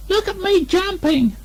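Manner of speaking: very high, slow little squeak. A narrator's reading. Roo squeaks to show off his jump. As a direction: squeak it out like a tiny joey. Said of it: "squeaked Roo".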